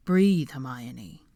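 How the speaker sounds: slow, low, soothing; steady her gently, slowly; calming Draco. Acted. Draco calming a panicking Hermione, slow soothing.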